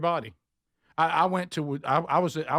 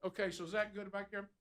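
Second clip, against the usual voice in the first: normal talking voice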